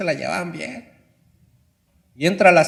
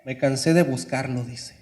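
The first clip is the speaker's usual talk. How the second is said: normal talking voice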